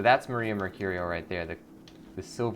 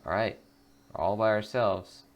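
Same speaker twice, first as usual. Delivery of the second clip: usual speaking voice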